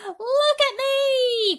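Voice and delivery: very high, slow joyful shout; excited shout. Acted. Centipede freed of paint, overjoyed high shout. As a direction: shout it with glee. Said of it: "cried the centipede".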